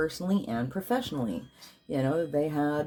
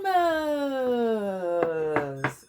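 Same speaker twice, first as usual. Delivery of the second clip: joyful exclaim; 7 semitones higher than usual. Real, not acted.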